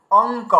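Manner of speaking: অঙ্ক said "ong-k", a clear ng before the k; anusvara as ng. Shown as a demonstration. Teacher showing students অঙ্ক with a distinct ng sound. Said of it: "তাহলে আমরা কি উচ্চারণ করছি?".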